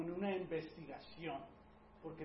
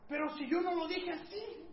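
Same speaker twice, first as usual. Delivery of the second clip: high, indignant protest from the offended spouse; mock-hurt spouse jumping into fighting stance; defensive spouse; 12 semitones higher, 6 dB louder than usual. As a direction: squeak out a defensive "but I didn't say it like that".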